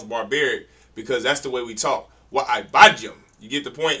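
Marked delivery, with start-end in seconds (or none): 2.3-3.1 Wa Ibadjum with Wa hit loud and set apart; emphasized Wa. Shown as a demonstration. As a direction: punch Wa, then Ibadjum. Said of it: "to make that emphasis of the Wa".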